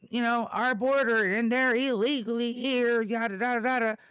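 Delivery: high, loud sneering imitation of a border hawk; mocking the caller. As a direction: parrot his argument back with scorn. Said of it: "For you to say".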